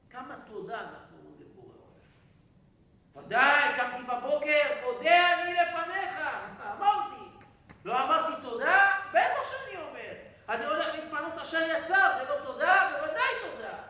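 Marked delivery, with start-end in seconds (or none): 3.3-13.8 loud, high mock protest; hammed-up defensive shouting; indignant self-justification. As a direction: insist noisily that of course you did. Acts out listener's defensive protest.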